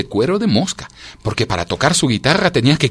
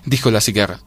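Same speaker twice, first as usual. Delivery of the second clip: usual speaking voice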